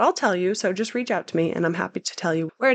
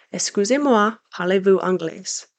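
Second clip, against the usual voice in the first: a louder, modest attempt at French for Excusez-moi; French phrase; 5 dB louder than usual. Shown as a demonstration. Vlogger demos the polite French question, light French accent. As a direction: try the French version with a learner's accent. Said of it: "I might not have a good accent".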